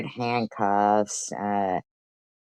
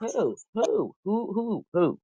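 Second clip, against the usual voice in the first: repeated who pitched higher and softer; taunting who; 10 semitones higher, 5 dB quieter, slower than usual. Real, not acted. Streamer mocks her rival, asking who, high and taunting. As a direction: needle her with a sing-song who.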